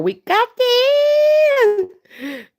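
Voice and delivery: very high, long-held squeal; rallying squeal. Real, not acted. Streamer cheering on her viewers, drawn-out rallying squeal. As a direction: stretch the last word into a high squeal.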